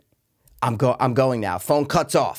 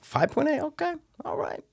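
incredulous; 13 semitones higher, 8 dB quieter than usual. Real, not acted. Reacting to co-host's low score.

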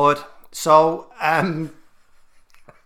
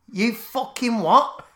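outraged disbelief; 7 semitones higher, slower than usual. Retold or imitated. Husband outraged at title.

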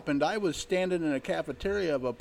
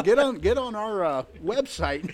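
home shopping host; 7 semitones higher, 9 dB louder, slower than usual. Pretend. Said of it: "mock sales pitch".

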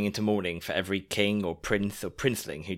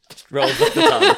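laughing; 12 dB louder than usual. Real, not acted. Laughing at long French name.

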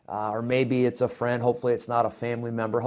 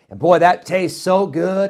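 normal talking voice

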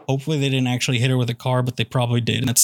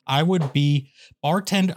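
ordinary delivery

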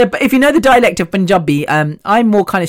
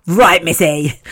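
stern therapist; slower than usual. Pretend. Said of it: "a piranha will come out going".

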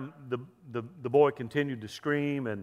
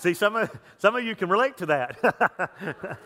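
higher-pitched, breaking into laughs; real laughter; 4 semitones higher than usual. Real, not acted. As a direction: talk through your own amusement at the joke. Laughing after his own punchline.

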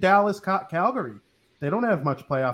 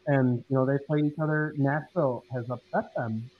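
muffled mic. Real, not acted. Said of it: "you sound muffled".

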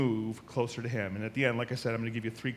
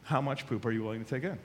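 serious repeat; 2 semitones lower than usual. Real, not acted. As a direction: drop the laugh and mean it. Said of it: "to a certain extent".

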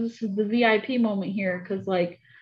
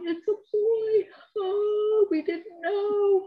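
high, slow, tearful cry of relief; mother in the birth video crying with joy; 13 semitones higher, much slower than usual. Retold or imitated. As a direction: sob it out, overjoyed at a boy. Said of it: "And I'm, like, crying".

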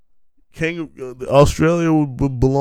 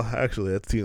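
ordinary talk

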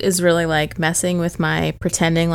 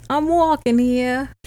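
slow mock New York accent; 6 semitones higher, slower than usual. Host hamming up a mock New York accent. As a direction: lean into a New York "I'm walking here". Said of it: "Partly New York".

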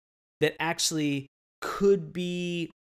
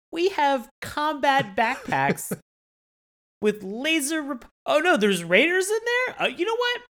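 high, emphatic mock reassurance; big hammed-up sales pitch, pitched way up; 9 semitones higher, 4 dB louder than usual. Host imitating show creators waving away raiders, mock confident pitch.

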